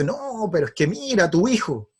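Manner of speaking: acted-out indignant dad, pitched high; angry father. Father griping to mother about their son, angry. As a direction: complain crossly as the fed-up father. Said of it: "y se empieza a enojar".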